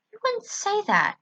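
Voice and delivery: suspicious daughter to her mother. Acted. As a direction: question her with disbelief. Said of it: "I frowned to her".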